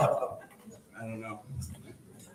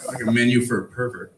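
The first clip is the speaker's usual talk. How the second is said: normal talking voice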